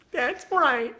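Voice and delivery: high-pitched mock stern mother; smug mother voice, hammed up; caricature mom. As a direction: answer as the satisfied scolding mom. Mom's reply in acted scene.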